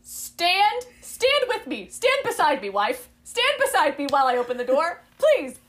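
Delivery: high, hammed-up plea; mock pleading wife. Wife begging husband to stand beside her, hammed-up. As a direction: overact the begging heroine.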